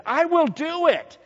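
loud, high, eager promise; hammed-up pious zeal; showy piety. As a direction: promise grandly for show. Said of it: "that's real good piety".

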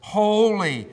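loud, single ringing call; seraph's proclamation. Acted. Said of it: "The attestation of the seraphs".

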